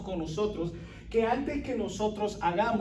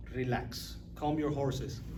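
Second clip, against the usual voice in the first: much lower and softer, teasing calm; mock soothing; 7 semitones lower, 6 dB quieter, slower than usual. Preacher mocking how people soothe the angry, low and soft. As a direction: hush them down lightly, mocking. Mock calming a would-be killer.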